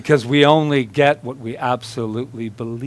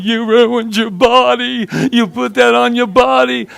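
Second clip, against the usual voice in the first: disapproving mother; 9 semitones higher, 3 dB louder than usual. Retold or imitated. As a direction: voice Mom tutting at the tattoo, pitched up. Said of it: "right now she's going".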